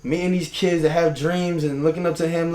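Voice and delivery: ordinary talk, nothing marked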